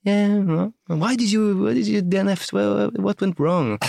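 high, loud quizzing voice; mock-imitating the chatty Turkish runner's grilling; eager foreign runner. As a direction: press him with questions like a keen fan.

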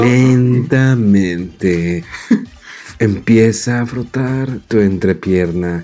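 low, slow seductive whisper-voice; incubus. Pretend. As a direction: purr it like a seducer. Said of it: "hablo con una voz sensual". (voz sensual grave y lenta)

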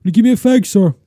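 put-on high, loud cheeky kid shout; shouting student. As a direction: yell it like a cheeky pupil. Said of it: "who would shout".

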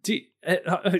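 usual speaking voice

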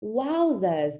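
names recited in mock British; put-on British pronunciation; fake British accent. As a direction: play at being British. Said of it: "with the British accent".

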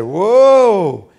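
loud, high, slow whoa of wonder; amazed young man. Retold or imitated. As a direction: marvel at the car. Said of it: "he said".

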